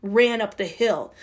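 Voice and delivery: usual speaking voice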